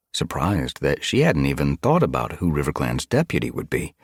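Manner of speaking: ordinary delivery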